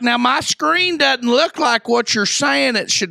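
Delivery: put-on high, slow old-lady complaint; mock old lady. Old lady confusing the scammer, slow high mock voice. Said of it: "he pretends to be some old lady".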